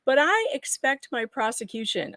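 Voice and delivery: normal talking voice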